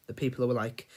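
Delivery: usual speaking voice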